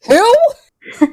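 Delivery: high, incredulous laughing who; baffled who. Retold or imitated. Leia scoffing at her rescuer, high and incredulous. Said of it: "Leia's first response is".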